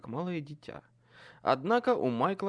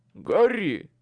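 plain reading voice

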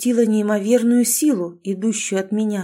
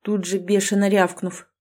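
ordinary delivery

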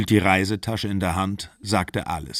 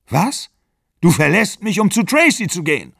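high, loud incredulous outburst; acted jealous outrage, voice leaping up; outraged disbelief; 9 semitones higher, 6 dB louder than usual. A narrator's reading. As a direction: fire back in shocked indignation. Acted outraged question in quarrel.

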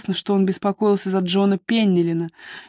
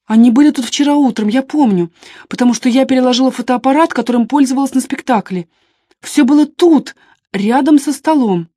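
higher, louder, agitated answer; raised, emphatic recollection; 4 semitones higher, 5 dB louder than usual. A narrator's reading. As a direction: insist on the memory, upset. Deborah answers questioning, upset.

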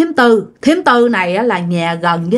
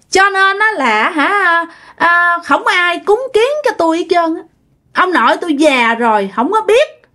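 put-on much higher, a childish whining ghost; hungry ghost's voice; 8 semitones higher than usual. Ghost explains to the mother why it possessed her, high and whiny. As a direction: plead and brag like a hungry spirit in a borrowed body. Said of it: "Mới nói rằng hả".

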